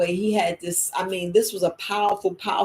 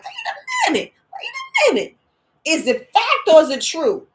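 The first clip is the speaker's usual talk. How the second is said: much higher, shouted wait a minute; shocked disbelief; 11 semitones higher, 4 dB louder than usual. Retold or imitated. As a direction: yell it out in stunned disbelief. Stunned by hearing the truth.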